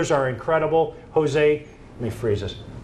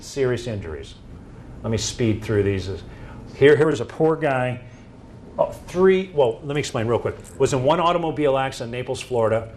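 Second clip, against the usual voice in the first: ordinary delivery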